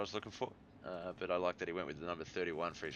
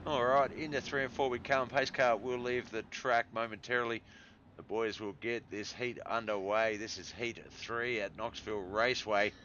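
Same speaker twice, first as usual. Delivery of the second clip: upbeat play-by-play race start; race announcer; 3 semitones higher, 4 dB louder than usual. Acted. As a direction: call the start like a speedway announcer.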